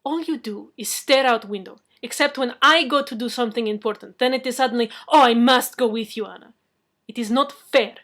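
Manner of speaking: put-on loud, accented, aggrieved; angry complaint. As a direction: pour out the grievance. Anna's complaint in anger.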